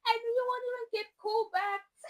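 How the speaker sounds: laughing jab. Real, not acted.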